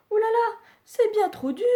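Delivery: put-on high, bright little girl complaining; a girl bouncing on a hard bed; Goldilocks voice. Goldilocks grumbling to herself that the bed is too hard.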